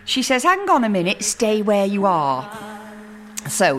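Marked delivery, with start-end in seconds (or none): 0.4-2.5 put-on higher Welsh accent, slowing at the end; lightly voiced Welsh lady accent. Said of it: "Did you like my little Welsh accent?".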